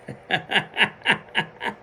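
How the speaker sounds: short breathy run of chuckles; amused rapid chuckle; real laugh. Real, not acted. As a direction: laugh at your own joke. Laughs at own waking groan.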